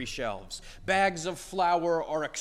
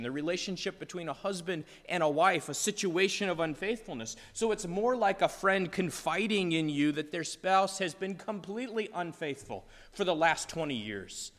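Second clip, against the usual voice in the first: ordinary delivery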